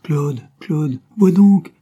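appel effaré, bien plus haut et fort; voix effarée. A narrator's reading. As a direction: crie son nom, affolée. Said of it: "la voix effarée de Christine". (Christine réveillée en sursaut appelle Claude, effarée)

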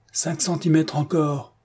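calling out. A narrator's reading. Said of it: "cria du bout de la pièce".

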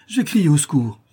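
high, loud threat to call for help; desperate warning cry. A narrator's reading. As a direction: cry it out at the intruder. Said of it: "s'écria la malheureuse mère".

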